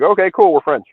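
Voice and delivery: high, loud, easygoing compliance; breezy mock agreement. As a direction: go along with it cheerfully, as a quote. Voicing Acadians going along.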